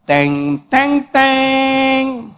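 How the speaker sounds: voiced "teng, teng, teng" film music; dramatic sting imitation. Storyteller mimics film music at the reveal, mock-dramatic.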